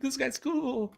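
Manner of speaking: high, loud nervous stranger voice; scared stranger. Retold or imitated. Streamer mocking a frightened stranger forcing cheer, high and loud. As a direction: squeak it out like someone scared.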